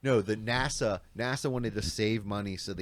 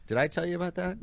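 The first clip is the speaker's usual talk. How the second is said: excited; 9 semitones higher than usual. Real, not acted. Excited about his bagel move.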